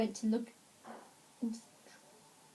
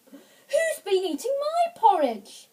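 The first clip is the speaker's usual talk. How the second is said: raised, higher mother bear voice; middle-sized bear voice; 9 semitones higher, 5 dB louder than usual. A narrator's reading. Mother Bear at her bowl, middle-sized voice. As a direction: ask it as an indignant Mother Bear. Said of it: "in a middle-sized voice".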